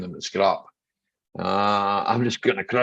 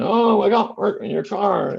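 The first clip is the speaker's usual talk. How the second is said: high, slow mocking whine; lightly mocking slow whine; mock whiny customer; 11 semitones higher than usual. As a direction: mimic a complaining customer. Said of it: "they start going".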